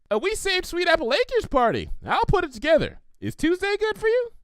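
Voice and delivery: high, bubbly cartoon pony voice; Pinkie Pie voice. Pinkie offering Applejack a party, high and bubbly.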